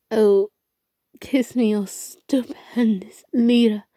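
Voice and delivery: a light mock-feminine swoon, slowed on the leader; choked mock swoon. A narrator's reading. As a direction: choke out a hammed-up flirt. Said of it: "he choked".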